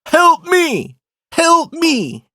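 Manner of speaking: very high, loud mock cry for help; imitated child's shouting. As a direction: mimic a little kid's shrill distress call. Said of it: "my son out of nowhere says".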